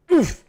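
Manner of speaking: a loud, high, chirpy oof sound effect; happy oof. Pretend. Streamer doing the Roblox oof for chat, loud and high. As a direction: squeak the Roblox oof out cheerfully. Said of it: "the oof Roblox sound in a happy voice".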